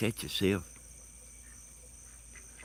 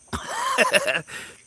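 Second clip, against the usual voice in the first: high burst of laughter; real laugh; 3 dB louder than usual. Real, not acted. Laughing at their own prank in the woods, high laugh. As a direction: crack up right after the shout.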